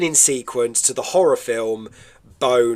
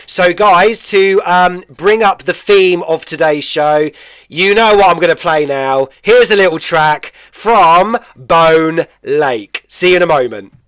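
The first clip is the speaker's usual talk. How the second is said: loud, projected DJ track intro; stretched-out, high-energy radio build-up; radio presenter link; 3 semitones higher, 6 dB louder than usual. Real, not acted. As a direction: announce the next track to listeners, big and punchy.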